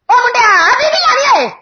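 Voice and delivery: high-pitched mock holler to a lad; hammed-up shouted question; calling out. Acts out calling to a boy.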